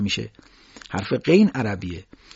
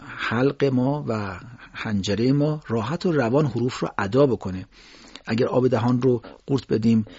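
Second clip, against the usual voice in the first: usual speaking voice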